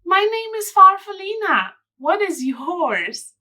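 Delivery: a small, friendly butterfly asking a name; Farfalina's voice. Acted. Reader voicing Farfalina to children, high and sweet. Farfalina introduces herself in story.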